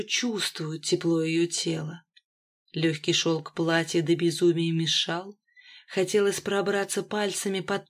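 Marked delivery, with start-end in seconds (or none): none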